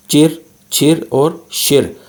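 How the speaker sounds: चिर, छिर और शिर slow, tongue curled back; retroflex chir chhir shir. Shown as a demonstration. Teacher reviewing retroflex syllables slowly. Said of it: "पिछली slide पर explain किए हैं".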